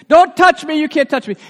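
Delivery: high, loud shout of "touch"; imitating another sermon's harsh Jesus; mock stern rebuke. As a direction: bark it like a preacher forbidding contact. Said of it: "I remember hearing a sermon saying".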